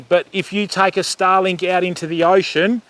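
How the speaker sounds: normal talking voice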